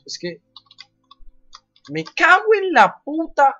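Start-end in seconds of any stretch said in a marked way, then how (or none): 1.9-3.6 maldición gritada y alargada; grítalo con rabia, lento; grito furioso. Real, not acted. Cae otra vez en el juego. (grito de frustración)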